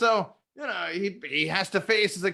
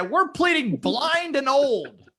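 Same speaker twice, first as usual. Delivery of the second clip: mock plea; 9 semitones higher, 4 dB louder, much slower than usual.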